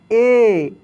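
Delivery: ए said with force; emphatic ए; loud ए. Shown as a demonstration. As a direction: punch ए. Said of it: "दूसरे को जोर लगा के".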